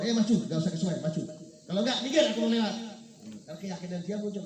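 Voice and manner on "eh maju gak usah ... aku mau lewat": loud, raised-pitch challenge; retold snapping shout. Ustadz orders the tiger to step aside, retold snapping shout. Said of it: "dibentak seperti itu".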